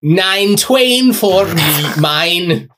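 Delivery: slow, high mock Old English song. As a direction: sing it in fake archaic English. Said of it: "talks in Old English".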